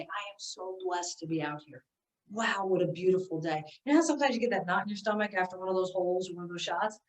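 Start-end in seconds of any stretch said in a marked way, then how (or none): 0.0-3.6 grateful. Shown as a demonstration. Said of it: "you look around and say".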